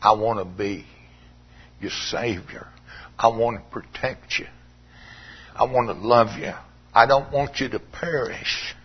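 soft, low, tender; tender plea. Retold or imitated. Jesus speaking tenderly to the sinner, softly. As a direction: offer it gently like a father. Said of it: "He says".

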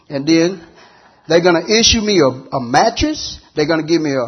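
loud, fast preacher's build; preaching at full force. Acted. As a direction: drive it home to the congregation.

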